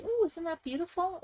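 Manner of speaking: mock admiring voice. Pretending the stuck card came out.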